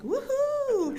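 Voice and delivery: high squealing cheer of joy; excited woohoo. Retold or imitated. Cheering on seeing her and her dad's names on the panel. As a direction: whoop like seeing your own name on the list. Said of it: "I was like".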